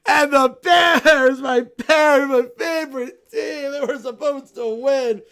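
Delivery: stomp through the complaints like a sore loser; whiny tantrum. Acted. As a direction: bear fan sulking furiously at the loss. Bear wails about his team.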